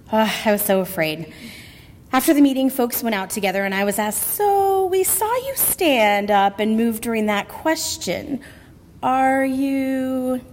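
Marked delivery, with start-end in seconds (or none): 4.2-10.4 slow, drawn-out prying question; probing question. Retold or imitated. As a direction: stretch it out leadingly, leave the end hanging. Friends probe her after the meeting.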